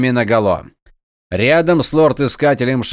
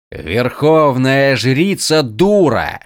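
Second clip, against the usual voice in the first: slow, louder growl; growling dog voice; 4 dB louder, much slower than usual. Acted. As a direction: snarl it out slowly like a dog. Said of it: "прорычал лорд-искатель". (рычащий, медленный голос)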